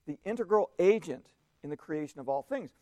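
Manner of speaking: ordinary talk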